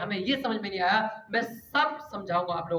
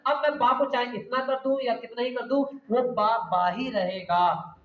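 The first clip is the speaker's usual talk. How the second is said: normal talking voice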